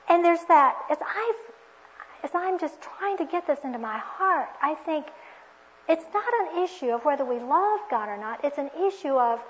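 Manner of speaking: ordinary delivery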